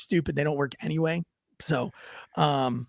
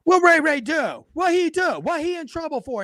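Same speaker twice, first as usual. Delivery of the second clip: alarmed neighbour; 10 semitones higher than usual. Retold or imitated. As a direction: ham up a worried neighbour fussing about Ray Ray. Neighbour alarmed about Ray Ray.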